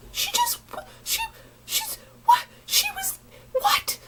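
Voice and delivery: squeaky, near-crying disbelief with the pitch shot way up; stunned disbelief. Retold or imitated. To people acting normal, high near-tearful disbelief. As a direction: choke out the words, half crying in shock.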